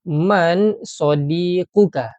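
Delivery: teacher modelling a question; slow model question. Shown as a demonstration. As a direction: say man sadiquka slowly for the class. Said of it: "kita cuba buat".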